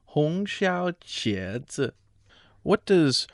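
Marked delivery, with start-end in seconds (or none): 0.0-1.9 slow, softer, copying "红烧茄子"; slow repeat. Shown as a demonstration. Learner copying the teacher, slow and careful.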